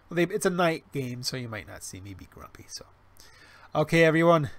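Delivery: ordinary delivery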